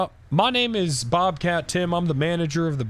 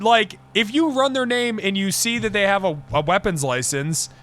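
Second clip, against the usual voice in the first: ordinary talk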